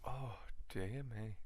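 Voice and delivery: soft, slow whispered sigh. Real, not acted. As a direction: breathe it out in a whisper. Whispered sympathy.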